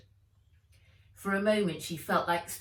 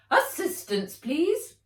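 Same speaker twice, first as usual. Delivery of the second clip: higher, louder brisk call; Fred's voice; 10 semitones higher, 7 dB louder than usual. A narrator's reading. Fred calling Nat to help in the lab, higher and louder. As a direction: call for help like a busy scientist.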